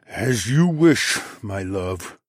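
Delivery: slow, strained, reluctant consent; through gritted teeth. A narrator's reading. Alpha giving in to his wife, through gritted teeth. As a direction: draw it out through gritted teeth, dropping on the endearment. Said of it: "he replied through gritted teeth".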